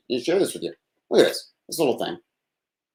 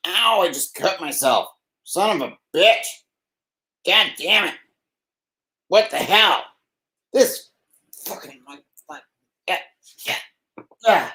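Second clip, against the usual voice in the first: pained; 6 semitones higher, slower than usual. Real, not acted. Just cut himself.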